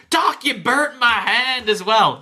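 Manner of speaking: high, drawn-out mock wail; complaining patient. Burnt patient scolds the doctor, high mock wail.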